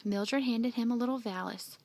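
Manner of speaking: ordinary delivery, nothing marked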